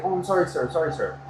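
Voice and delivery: soft, deferential retold apology; humble apology. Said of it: "he's very, like, humble".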